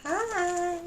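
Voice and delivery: high, loud, long-held greeting; mimicking advert woman. Mocking a woman arriving with chocolates, exaggerated greeting. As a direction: sing out an exaggerated hello. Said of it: "this woman comes in and ... goes".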